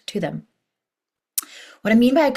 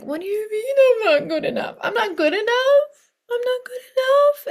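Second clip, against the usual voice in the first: much higher, crying, disbelieving wail; wailing emotional body; 4 dB louder than usual. Acted. The emotional body wailing at the brain, much higher and crying. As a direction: whimper it out like a hurt child on the verge of tears. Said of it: "there they go, crying again".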